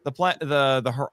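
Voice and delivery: ordinary talk, nothing marked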